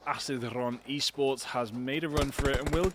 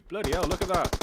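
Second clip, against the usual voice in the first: surprised; 5 semitones higher, 6 dB louder than usual. Real, not acted. Sudden surprising sight.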